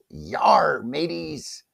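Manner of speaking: playful pirate growl; a quick hammed-up pirate hail. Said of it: "today is Talk Like a Pirate Day".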